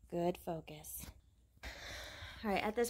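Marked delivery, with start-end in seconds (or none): 0.1-1.1 near-whispered low praise; soft praise. Real, not acted. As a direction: hush it, barely voiced. Soft praise while fainting.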